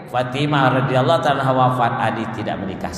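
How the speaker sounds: ordinary talk, nothing marked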